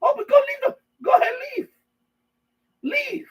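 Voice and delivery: cartoonish jailer urging him out; mock taunting. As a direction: shoo him out into space. Shoving prisoner out the door.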